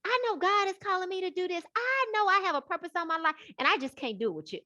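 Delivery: high, fast pleading voice; high and fast, announcing she's leaving; retold own younger voice. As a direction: rush it out, high and insistent. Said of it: "It was like".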